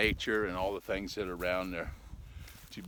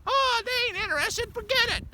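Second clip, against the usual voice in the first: very high, loud squeaky put-on voice; squeaky heckler; 7 dB louder than usual. Heckler cutting off his story, very high squeaky voice. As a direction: heckle in a piercing little squeal.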